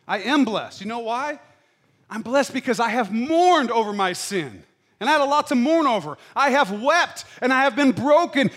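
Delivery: loud, high impassioned testimony; preaching at full force. Real, not acted. Near-shouted confession, pouring it out. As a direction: pastor confessing his own brokenness to the church, fervent shouted testimony.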